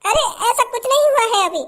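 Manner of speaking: coy falsetto of a new bride; put-on bride voice. New bride teasing her eager husband, coy put-on voice.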